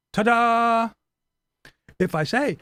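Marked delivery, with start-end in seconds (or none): 0.1-0.9 loud, high showman's flourish; ta-da with a showy flourish; triumphant ta-da. Pretend. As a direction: present it like a magic trick.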